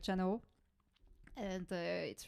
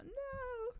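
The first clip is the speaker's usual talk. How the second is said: mock high plea; 3 dB quieter than usual.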